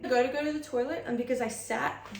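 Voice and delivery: normal talking voice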